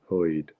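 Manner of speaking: "hoid" with an oy vowel for heard; New York "hoid". Shown as a demonstration. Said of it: "a fun way to pronounce".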